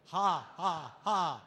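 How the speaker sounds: loud open ha ha ha; leading the standing crowd in laughter yoga, loud hearty ha. Shown as a demonstration. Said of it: "we're gonna start down here".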